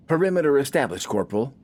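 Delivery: crisp report. Acted. Soldier reports to corporal.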